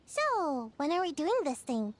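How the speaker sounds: ordinary talk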